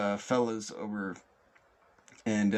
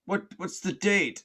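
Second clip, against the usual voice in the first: high, raised acted question; yelling police officer; 10 semitones higher, 5 dB louder than usual. Police officer quizzes man in pond, yelling. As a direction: call the question over. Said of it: "And then he yells".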